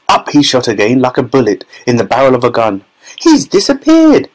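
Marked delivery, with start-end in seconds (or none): 3.2-4.3 very high, shrieky cry; panicked yell. A narrator's reading. Mother yelling that her son has vanished. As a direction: yell it in alarm at the top of the range. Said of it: "yelled Missus Gloop".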